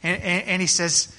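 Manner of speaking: usual speaking voice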